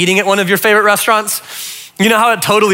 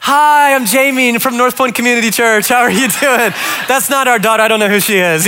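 fake-cheerful greeting; 4 semitones higher than usual. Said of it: "And I'm like".

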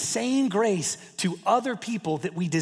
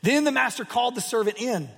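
ordinary delivery

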